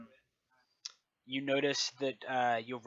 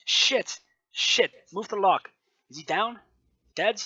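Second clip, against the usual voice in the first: high, loud panicked-comms shout; calling out; 8 semitones higher, 9 dB louder, slower than usual. Acted. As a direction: call it out urgently over the squad radio. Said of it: "somebody calling out".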